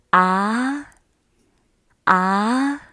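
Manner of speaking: ah drawn out, sliding up; rising tone. Shown as a demonstration. Teacher leading students in second-tone practice, ah rising. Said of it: "The second one is a rising tone".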